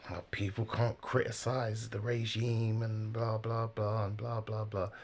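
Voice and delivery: slow, droning recital of complaints; mocking drone. Commentator parodies the critics of China's regime, mocking drone. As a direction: rattle off tired criticisms in a dismissive singsong.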